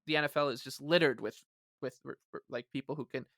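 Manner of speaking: ordinary talk, nothing marked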